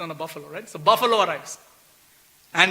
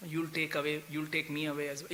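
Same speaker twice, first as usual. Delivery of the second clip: hushed fearful thought; 5 semitones lower, 14 dB quieter than usual. Retold or imitated. Devotee fears being taken away.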